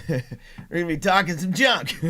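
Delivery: normal talking voice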